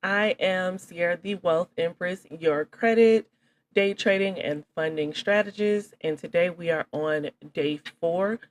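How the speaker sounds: upbeat host introduction, slow and loud; announcer intro. Real, not acted. Formal course introduction.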